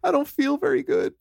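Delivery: high, soft, hammed-up frightened plea; mock scared plea. Parodying the dying hero to cohost, high soft mock fear. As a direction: beg like a fading hero, playfully. Repeats the death scene quote.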